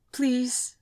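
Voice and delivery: dying woman begging her friend. A narrator's reading. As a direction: beg gently through the pain. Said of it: "Isabel begged me".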